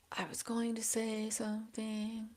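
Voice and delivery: breathy whisper to herself; a real soft whisper while trying to remember. Real, not acted. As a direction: whisper it, voiceless, almost under the breath.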